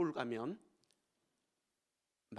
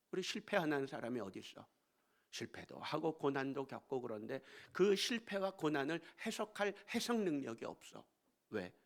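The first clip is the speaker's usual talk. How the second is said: ordinary talk, nothing marked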